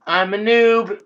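loud high gleeful yell; high, loud happy cry; excited shout. Real, not acted. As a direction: shout it out while falling. Shouting while jumping.

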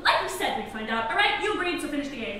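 ordinary talk

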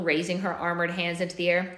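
plain reading voice

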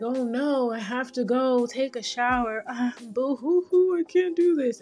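hammed-up tearful helplessness; mock overwhelmed. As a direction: play-act being overwhelmed, rising into a mock sob. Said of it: "super overwhelmed because it's like".

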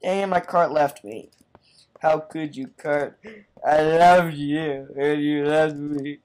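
hammed-up heartbroken wail, drawn out toward the end; mock crying. Player abandoned by a minecart, mock crying. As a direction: wail like a jilted lover, jokingly. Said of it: "I'm really crying".

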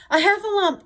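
very high, loud yell; Pooh's alarmed cry. A narrator's reading. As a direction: shriek the alarm. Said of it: "cried Pooh".

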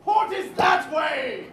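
loud exasperated yelling; angry shout. Acted. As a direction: bellow at the confused crew. Captain scolding wrong direction again.

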